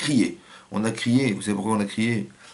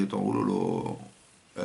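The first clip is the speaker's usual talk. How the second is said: much lower and slow, taken aback; dismayed husband; 7 dB quieter, much slower than usual. Retold or imitated. Husband reacting to his wife's scream, slow and low. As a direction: drag it out low, as if alarmed. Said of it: "je me dis".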